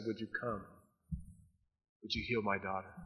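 soft pleading request; father quietly begging Jesus to heal his daughter; quiet plea. Acted. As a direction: hush it like a desperate father. Desperate father pleading softly.

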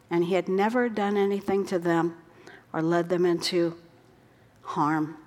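ordinary talk

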